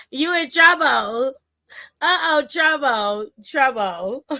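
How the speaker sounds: slow singsong teasing through laughter; mock warning, cracking up; laughing taunt. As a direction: gloat playfully, half laughing. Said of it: "And I was like".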